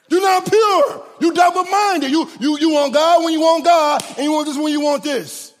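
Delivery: preaching climax. Real, not acted.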